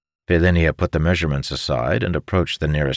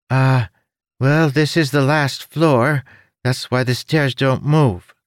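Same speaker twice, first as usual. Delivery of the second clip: high, odd little creature voice; chirpy winged creature, helpful; 9 semitones higher, 4 dB louder than usual. Acted.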